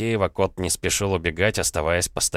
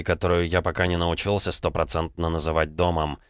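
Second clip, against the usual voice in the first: ordinary delivery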